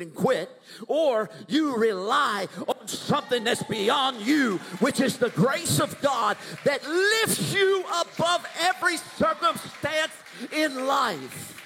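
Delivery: high, loud preacher's shout; preaching at full force. Real, not acted. Preacher shouting grace over the congregation. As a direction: lift the church with a ringing cry.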